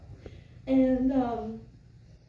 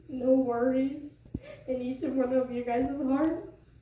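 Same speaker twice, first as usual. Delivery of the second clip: normal talking voice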